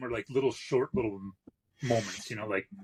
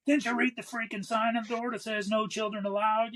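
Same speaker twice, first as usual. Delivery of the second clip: high, exasperated mock rant; angry shop owner; 11 semitones higher than usual. Shop owner ranting at a parent who brought kids, exasperated. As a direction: scold the parents like a fed-up boss. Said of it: "he's like".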